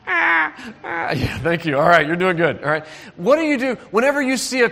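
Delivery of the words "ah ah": high mock baby wail; hammed-up baby crying noise. Shown as a demonstration. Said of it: "make a crying noise for me".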